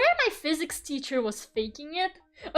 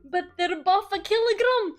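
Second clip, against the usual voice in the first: laughing, higher-pitched Scottish mimicry; Scottish accent; 6 semitones higher than usual. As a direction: imitate a Scottish sketch through giggles. Repeating the Scottish sketch while laughing.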